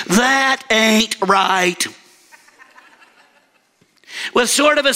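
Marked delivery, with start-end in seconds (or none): 0.1-1.8 a hint of slow mid-Missouri drawl. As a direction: stretch it out slowly, lightly mid-Missouri. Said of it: "in the words of, uh, of some of us in mid-Missouri".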